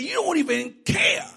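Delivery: loud, high indignant rant; indignant Martha. Acted. Martha tells the Lord she did all the cooking, loud and indignant. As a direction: list every dish you made, fuming. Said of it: "She said".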